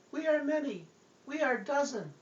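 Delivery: eerie little voice from within; little possessed voice. Acted. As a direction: squeak like a small spirit inside you. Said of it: "I heard this voice".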